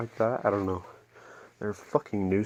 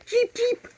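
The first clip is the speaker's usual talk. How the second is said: very high squeaky cheep; bird chirp imitation; 4 dB louder than usual.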